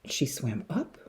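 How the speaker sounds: usual speaking voice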